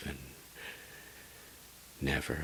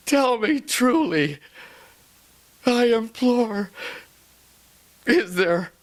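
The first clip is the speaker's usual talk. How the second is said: high and loud, voice cracking; anguished shouting plea; 14 dB louder than usual. Acted. Lover desperately imploring the raven for hope. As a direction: beg the bird for an answer.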